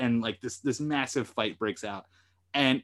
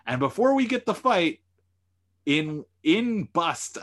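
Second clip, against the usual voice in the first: usual speaking voice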